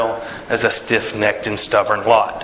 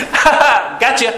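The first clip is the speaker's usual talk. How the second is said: high gloating ha ha; smug high-pitched jeer; mocking laugh; 12 semitones higher, 3 dB louder than usual. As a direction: taunt them with a cackling gotcha. God taunting Pharaoh's army.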